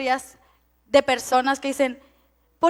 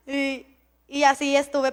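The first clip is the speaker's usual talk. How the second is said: usual speaking voice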